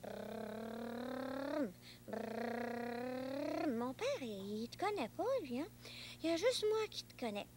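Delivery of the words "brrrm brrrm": low rumbling brrrm of a taxi motor; car engine noise. Pretend. Boy pretends to drive his imaginary friend in a taxi, engine rumble.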